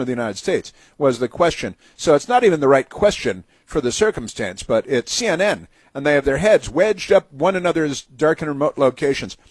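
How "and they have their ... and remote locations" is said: ordinary talk, nothing marked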